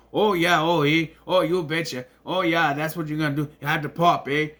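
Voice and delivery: mock Fargo-style Minnesota accent; exaggerated "you betcha" Minnesota accent; Minnesotan accent. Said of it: "they frame Minnesota as this".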